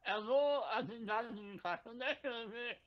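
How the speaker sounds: high-pitched mock Indian operator; Indian accent. Co-host answering a customer service call, Indian accent. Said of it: "you put on an Indian accent".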